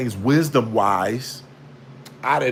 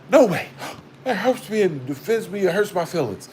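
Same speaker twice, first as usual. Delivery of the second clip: higher, breathy-gasp prissy voice of the offended; hammed-up wounded churchgoer clutching pearls; mock offended; 4 semitones higher than usual. Mocking offended church people, mock hurt whine.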